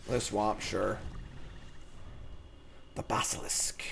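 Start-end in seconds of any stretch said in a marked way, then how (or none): 2.9-3.8 hushed, ominous whisper; whispered name. Pretend. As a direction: breathe the name like a threat. Ominous card reveal.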